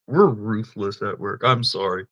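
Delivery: normal talking voice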